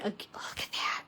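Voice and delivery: soft breathy whisper. Real, not acted. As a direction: hush it, admiring. Admiring paper quietly.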